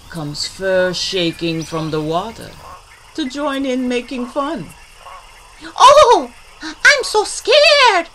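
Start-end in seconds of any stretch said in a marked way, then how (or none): 5.8-8.1 high, loud, hammed-up fright; mocking fear. Otter makes fun of gator, mock scared. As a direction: squeal it in fake terror. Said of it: "he mocks".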